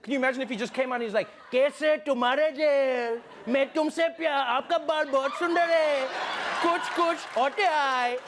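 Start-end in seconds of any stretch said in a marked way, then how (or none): 1.5-8.2 high-pitched, drawn-out mock Hindi declamation; hammed-up fluent Hindi impression; Trump fluent in Hindi. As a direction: belt out showy Hindi as an imagined politician. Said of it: "if Trump had come out all fluent in Hindi".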